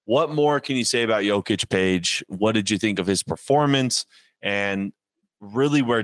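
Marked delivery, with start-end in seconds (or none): none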